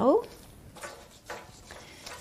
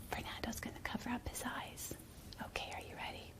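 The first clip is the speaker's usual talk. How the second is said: hushed game whisper; playful whisper to a child; 26 dB quieter, faster than usual. Acted. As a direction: whisper it like a secret. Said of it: "game whisper to child".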